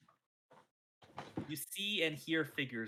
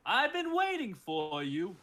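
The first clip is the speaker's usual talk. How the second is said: slow booming villain voice; dopey cartoon bellow; 6 semitones higher, 5 dB louder, slower than usual. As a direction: boom it out slowly. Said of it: "you hear a booming voice".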